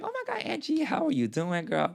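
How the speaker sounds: high, squealing hello to a friend; excited greeting. Retold or imitated. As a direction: gush over seeing a star. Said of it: "I was like".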